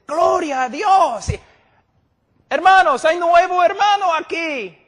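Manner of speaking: a pastor shouting out a new convert; hammed-up shouting pastor. Pastor to the church, hammed-up shouting announcement. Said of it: "Y el pastor dice".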